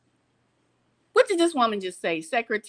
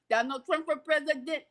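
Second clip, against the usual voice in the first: usual speaking voice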